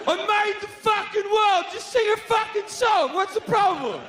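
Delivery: an irritated creator blowing up; furious God shout. Acted. God yelling at people.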